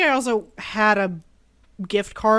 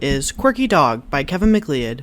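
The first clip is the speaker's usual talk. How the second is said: credits read; 7 semitones lower, 4 dB louder than usual. Acted. Reads music credit.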